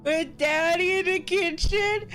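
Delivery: high, loud, drawn-out whine; distressed little girl. Acted. Frightened girl recounts the house fire to Sukuna, wailing. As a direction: wail it slowly, words dragged through mock tears. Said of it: "She replies".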